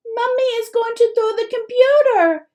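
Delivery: put-on a four-year-old thrilled; disbelieving joy. A narrator's reading. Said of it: "in disbelieving joy".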